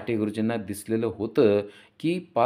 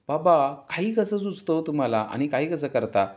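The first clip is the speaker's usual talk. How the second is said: higher, lightly voiced daughter; amused daughter; 6 semitones higher, 3 dB louder than usual. A narrator's reading. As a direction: tease dad as his amused daughter. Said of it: "हसू लागते आणि म्हणते".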